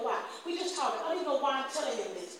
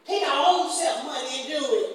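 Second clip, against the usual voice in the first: preaching call; 3 semitones higher, 5 dB louder than usual. Real, not acted. Preacher urging the congregation to respond, loud and fervent. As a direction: rouse them to answer back.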